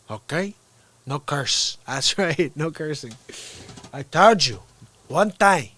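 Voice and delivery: lightly voiced Tony Montana quotes; a hint of a Cuban gangster; Scarface Cuban accent. Streamer acting Scarface lines for viewers, light Cuban accent. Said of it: "That's my favorite line in Scarface".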